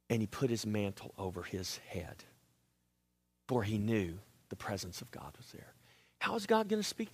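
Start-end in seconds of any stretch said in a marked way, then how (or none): 0.1-5.7 breathy unvoiced whisper; telling the church in an awed whisper. Shown as a demonstration. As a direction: keep it hushed and reverent, barely voiced. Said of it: "small whispering voice".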